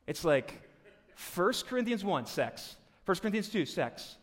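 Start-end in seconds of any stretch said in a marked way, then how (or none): none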